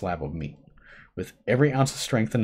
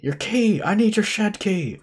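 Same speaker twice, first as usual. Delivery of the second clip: high urgent yell; screaming; 13 semitones higher, 4 dB louder than usual. Acted. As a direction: yell it while running. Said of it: "while screaming".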